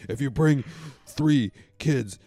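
loud, slow hyped announcer; monster truck ad. Pretend. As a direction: drag it out like a promo. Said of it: "monster truck ad voice".

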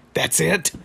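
mock incredulous. Rigby questions the wizard's petty revenge, mock incredulous. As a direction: act out baffled disbelief, pitch jumping up. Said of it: "And then Rigby's like".

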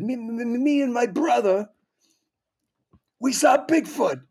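ferocious stuttering character, high and loud; strained high-pitched stammering stage voice; Bob Goldthwait. Retold or imitated. Comic imitating friend's stage act to an audience, ferocious stammer. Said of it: "he's using this ferocious voice".

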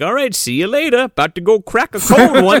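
high, loud put-on character voice; breezy send-off. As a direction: toss off a hammed-up goodbye. Voicing Prescott's casual sign-off.

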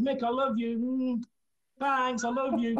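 high sing-song I love you; sugary affection. Retold or imitated. To the angry foreman, a high sugary mock love. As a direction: tease him with sweetness.

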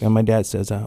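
normal talking voice

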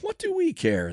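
very high-pitched scornful retort; dismissive retort. A narrator's reading. Priests answering Judas, high dismissive retort. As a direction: throw it back at him, pitch way up. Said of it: "they retorted".

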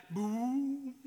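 jokey spooky boo; playful boo. Retold or imitated. Jesus appearing in a locked room, mock spooky boo. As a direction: pop in and spook them. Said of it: "you're just suddenly like".

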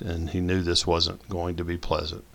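plain reading voice

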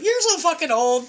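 high, drawn-out teasing; mocking jab. Retells teasing his stepdad.

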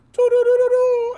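high, loud electronic beeping; a sci-fi machine sound; alien probe noise. Retold or imitated. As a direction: alien probe whirring toward the victim. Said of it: "the sound effects brought it home".

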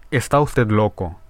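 ordinary delivery, nothing marked

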